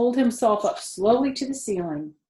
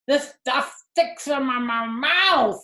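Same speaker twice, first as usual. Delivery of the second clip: higher, louder grumbling rat with a full mouth; complaining; 6 semitones higher, 6 dB louder, slower than usual. Acted. Templeton complaining to Wilbur while cutting the sack, grumbling. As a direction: grumble through sticky mouthful. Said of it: "he complained".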